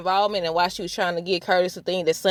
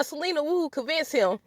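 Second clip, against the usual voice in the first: normal talking voice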